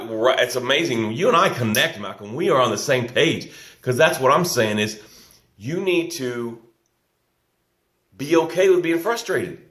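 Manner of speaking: ordinary delivery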